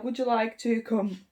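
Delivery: ordinary delivery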